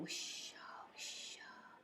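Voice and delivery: soft breathy wish-ah of the leaves; whispered leaf rustle. A narrator's reading.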